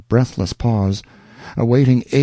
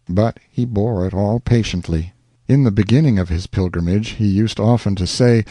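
ordinary delivery

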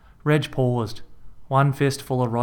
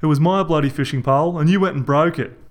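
louder, mouthful-muffled retort; muffled yell; 5 semitones higher, 5 dB louder, faster than usual. A narrator's reading. Reg shouting with his teeth in Rog's pants. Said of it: "muffled by a mouthful".

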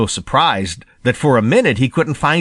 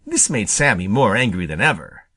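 ordinary delivery, nothing marked

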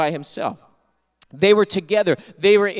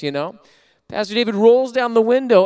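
usual speaking voice

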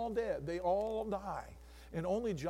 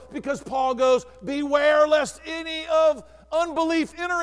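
loud, higher shouting appeal; full-force preaching; 9 semitones higher, 11 dB louder than usual. Acted. Pastor warning the congregation not to die in the wilderness, shouted preaching.

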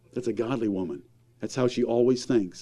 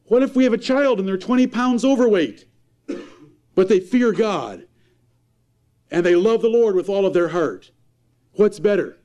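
raised, high-pitched pulpit preaching; fiery preaching; 8 semitones higher, 3 dB louder than usual. Acted. Preacher scolding parents' priorities, impassioned and raised. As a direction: preach it hard to the congregation.

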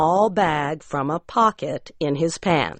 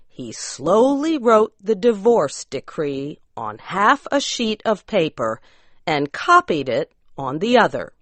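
ordinary delivery

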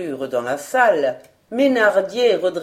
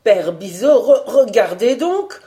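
louder, higher, stumbling over the words; stammering in alarm; 4 semitones higher, 5 dB louder than usual. Acted. Young guard to old colleague, stammering in alarm. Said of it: "bégaya-t-il".

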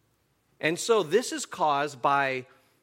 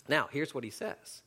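ordinary talk, nothing marked

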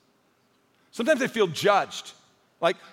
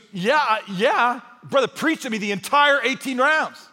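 higher, exasperated grumble; angry golfer; 6 semitones higher, 5 dB louder, slower than usual. Retold or imitated. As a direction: vent like a fed-up golfer. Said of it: "Why are you so angry".

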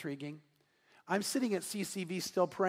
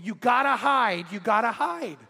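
urgent warning; 4 semitones higher, 6 dB louder than usual. Retold or imitated. Staff member runs up urging hiding.

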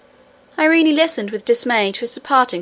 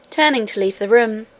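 plain reading voice

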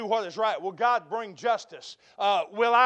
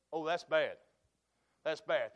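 much softer, lower oh that's bad; hushed aside; 6 semitones lower, 8 dB quieter than usual. Preacher hushing after naming one woe, quiet. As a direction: drop low and grave.

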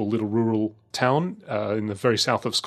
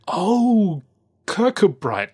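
high, loud drawn-out "oh" of recognition; dawning realisation; 9 semitones higher, 5 dB louder, much slower than usual. Retold or imitated. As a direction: act out an official suddenly catching on. Customs man's sudden mock realisation.

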